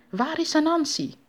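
high-pitched, cross demand; angry. A narrator's reading. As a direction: demand it crossly in the American role. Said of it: "vroeg hij boos".

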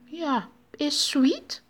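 high, drawn-out American character voice; hammed-up foreign visitor; fake American. A narrator's reading. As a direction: answer slowly as the put-on American. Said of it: "antwoordde de Amerikaan".

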